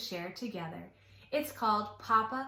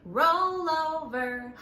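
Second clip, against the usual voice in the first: slow, louder roll over call; bear's command; 5 semitones higher, 4 dB louder, slower than usual. A narrator's reading. Brown bear telling the others to roll over, slow and loud. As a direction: draw out each roll over like a grumpy bear. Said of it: "the brown bear said".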